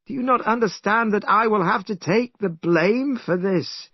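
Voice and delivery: a hint of a high, lecturing old teacher; lightly voiced stern master. A narrator's reading. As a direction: preach at the followers, mildly stern. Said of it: "cried Tripitaka to his followers".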